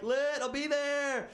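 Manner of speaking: high, slow mock enthusiasm; mock eager. Comedian accepting the invite, high mock eagerness. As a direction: drag it out, pretending to be keen. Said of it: "And I was like".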